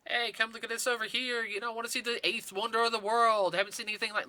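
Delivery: loud, high carnival barker call. Retold or imitated. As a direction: pitch it like a sideshow barker. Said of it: "what they used to call in the vaudeville or the carnies, barkers".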